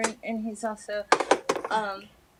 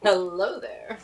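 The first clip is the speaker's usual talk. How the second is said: flirty grandfather; 2 semitones lower, slower than usual. Retold or imitated. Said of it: "he'd be like".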